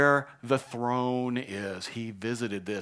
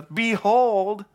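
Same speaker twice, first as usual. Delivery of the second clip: loud, high, amazed cry; big astonished "behold"; awed exclamation; 9 semitones higher, 8 dB louder than usual. Acted. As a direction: announce it with wonder. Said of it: "he just says".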